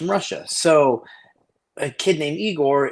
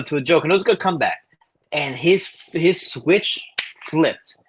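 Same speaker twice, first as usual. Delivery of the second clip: ordinary delivery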